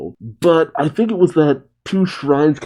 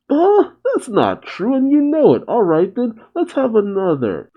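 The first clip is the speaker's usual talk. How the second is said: mock shout; 10 semitones higher than usual. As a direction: squeak out an indignant protest. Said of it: "Kiba shouts".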